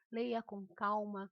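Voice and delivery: normal talking voice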